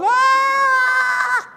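high, loud mock scream of a lost dad; panicked scream. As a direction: shriek like you have no idea what to do. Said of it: "Oh, I'm going".

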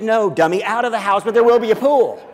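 a light mock girlfriend, teasing. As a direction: girlfriend calling him a dummy.

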